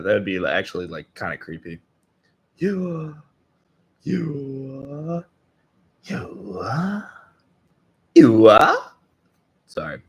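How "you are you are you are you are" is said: high, rising "you are", growing suddenly loud; trying the inhale. Shown as a demonstration. Listener trying what felt creepy, strained inhaled "you are". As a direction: draw "you are" upward on an attempted indrawn breath. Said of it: "It's the inhale for me".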